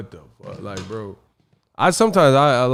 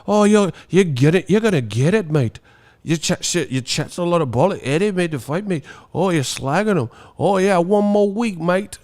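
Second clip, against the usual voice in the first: higher, hammed-up British accent; mock British fan; 5 semitones higher than usual. UK fan answers about Fury Joshua, mock British accent. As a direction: rattle off British slang in parody. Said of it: "the British teeth be like".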